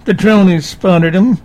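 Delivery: loud, clipped military radio chatter; barking orders over a crackly radio. Pretend. Mock drone pilot radio call.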